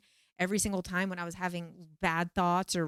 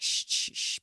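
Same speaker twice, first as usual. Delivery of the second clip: shushing. Retold or imitated. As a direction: hush her gently, no voice. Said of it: "silent ceremony".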